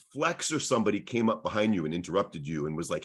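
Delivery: ordinary talk, nothing marked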